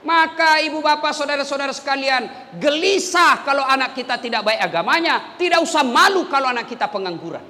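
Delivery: rally the congregation; fervent preacher urging parents; rousing exhortation. Acted. Preaching at full force.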